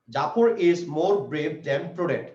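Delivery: drawn-out, very slow reading; very slow dictation. Shown as a demonstration. Teacher dictating the contrast sentence to students, very slow. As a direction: stretch each word so the class can write it. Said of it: "খেয়াল করো".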